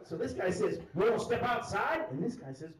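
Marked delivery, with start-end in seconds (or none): 0.9-2.1 slow, higher fight challenge; drawled-out mock threat. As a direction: imitate a drunk calling someone outside. Retold drunk challenges another to fight.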